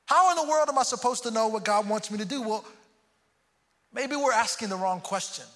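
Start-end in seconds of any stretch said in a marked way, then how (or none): none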